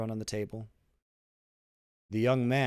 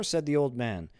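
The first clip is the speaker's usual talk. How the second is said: plain reading voice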